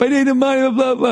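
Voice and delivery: very high mock yell; high-pitched mock ranting; retold yelling. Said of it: "he's yelling over the top".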